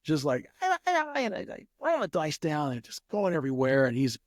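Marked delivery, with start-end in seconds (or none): 0.6-1.7 shrill-pitched "ah, ah, ah", without real volume; high-pitched mock yelling. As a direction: imitate an angry man's wordless high yelling. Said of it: "he's yelling".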